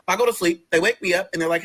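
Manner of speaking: ordinary talk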